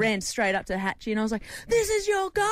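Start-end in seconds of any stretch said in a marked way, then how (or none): 1.7-2.4 high, loud retold cheer to a teammate; mock-excited shout of congratulations. Player cheering her teammate's goal, an excited call.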